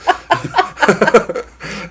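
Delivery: high, loud laughing burst; high burst of laughter. Real, not acted. Laughs at the T-shirt.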